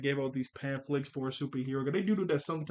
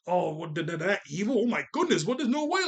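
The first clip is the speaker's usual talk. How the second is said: acting surprised; 3 semitones higher than usual. Mimicking the Gammas' staged shock, mock surprised. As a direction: pitch climbing higher into mock shock. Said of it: "they were acting really surprised".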